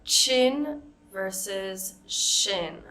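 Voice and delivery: slow minimal pair; ch versus sh. Shown as a demonstration. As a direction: contrast chin and shin slowly. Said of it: "So for example".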